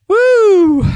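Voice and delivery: high, loud whoop; excited whoop. Real, not acted. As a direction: let out a big cheer. Cheering about old film.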